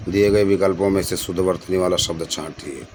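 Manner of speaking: ordinary talk, nothing marked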